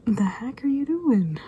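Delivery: slow, high mock disbelief; baffled. Spotting a strange cat in the garage, baffled question.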